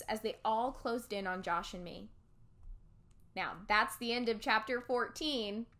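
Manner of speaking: usual speaking voice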